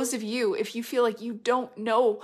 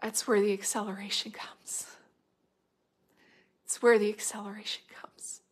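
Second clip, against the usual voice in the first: whispered; 5 dB quieter than usual. Acted. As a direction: whisper it softly, unvoiced. Hushed repeat of the point.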